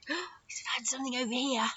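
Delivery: whispered discovery; secretive stage whisper; hushed suspense. Pretend. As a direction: whisper it softly to the children. Hushed suspense as puppet finds something.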